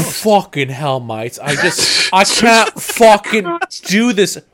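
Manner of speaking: exasperated mock rant, climbing high and loud; loud, fed-up comic impression, last words drawn out; Badge. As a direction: build to a strained, hammed-up outburst. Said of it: "make the best impression of Badge".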